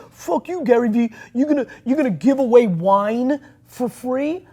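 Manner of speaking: high, loud scoffing outburst; mock angry designers. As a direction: sneer it like an offended designer. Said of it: "They're like".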